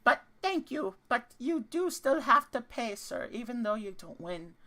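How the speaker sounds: high, chirpy gnome collecting coins; a smug little gnome, sweetly polite; gnome merchant voice. Acted.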